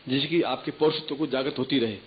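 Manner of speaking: ordinary talk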